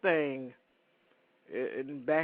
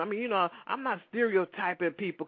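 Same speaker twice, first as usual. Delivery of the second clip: usual speaking voice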